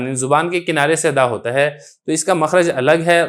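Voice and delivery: ordinary talk, nothing marked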